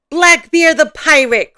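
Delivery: loud, high, slow pirate announcement; big theatrical proclamation, drawn out; pirate's cry. Acted. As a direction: cry it out grandly as a fearsome pirate. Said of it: "cried Papa".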